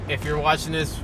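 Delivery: ordinary delivery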